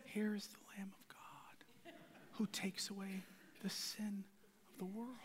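flat breathy whisper, no energy; timid whispered mumble. Shown as a demonstration. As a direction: whisper it limply. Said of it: "it does not mean that he says".

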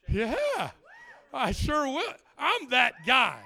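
loud, high, booming yes; emphatic, drawn-out enthusiasm; eager acceptance. Acted. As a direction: jump at the invitation at full volume. Keen reply to an invitation.

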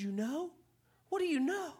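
a bride caught off guard, voice jumping up; startled question. Acted. Bride asks husband what he knows, startled and rising. Amanda shocked by Bob's reply.

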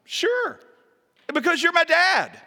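put-on high, piping, loud kid voice; child's reply. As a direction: answer like a confident little boy. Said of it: "responded with a remarkable calmness".

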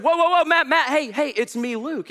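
high, urgent call to a friend. Acted. Dreamer on the stairway hails his friend Matt, calling out high. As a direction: flag someone down, voice jumping up.

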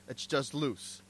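ordinary talk

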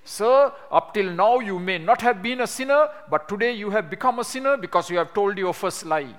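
put-on angry young evangelist accusing; militant retort. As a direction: lay into him with rising heat. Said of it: "my body was all shaking".

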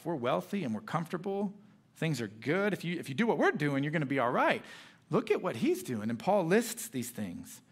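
ordinary delivery

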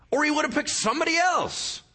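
high, loud, urgent preaching; preaching at full force. Acted. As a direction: preach it hard to the congregation.

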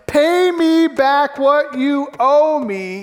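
debtor's grip, slow threatening demand. A narrator's reading. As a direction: lean in and threaten slowly. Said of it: "he demanded".

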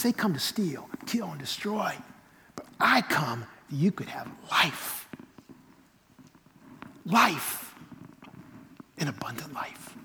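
hushed, reverent, near-whispered scripture with pauses; emphasis on life. Shown as a demonstration. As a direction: let "life" stand alone, softly. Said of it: "The emphasis on the life".

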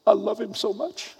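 high, soft, overcome little voice; imitating tearful kid. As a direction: play a boy choked up with adoration. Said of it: "this kid had tears".

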